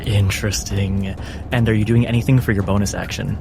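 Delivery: ordinary talk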